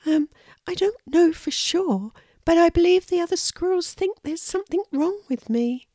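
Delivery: high small voice, mildly sad; a squirrel admitting a hurt; sad little squirrel. A narrator's reading. Lulu explains her rejection to the hedgehog, high and mildly sad. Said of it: "she said sadly".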